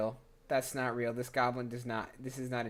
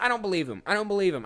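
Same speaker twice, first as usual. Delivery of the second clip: higher and louder, mock indignant; emphatic; 6 semitones higher, 6 dB louder, faster than usual. Real, not acted. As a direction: protest playfully, punch each word. Playful protest about the script.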